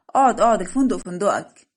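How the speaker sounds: loud, playful bossy order; bossy command. Acted. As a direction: order him about at full voice. Hungry bride orders him to sit. (أمر مرح بصوت عالٍ)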